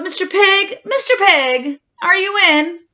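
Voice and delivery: calling loudly to the pig. Acted. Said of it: "I called".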